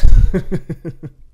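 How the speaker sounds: big hearty laugh; loud real laugh; loud burst of laughter. Real, not acted. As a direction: crack up loudly. After mocking viewers.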